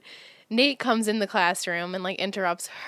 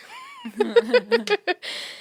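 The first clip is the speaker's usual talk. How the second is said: long high-pitched laugh; high laughing fit. Real, not acted. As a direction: crack up laughing. Laughing at her own script.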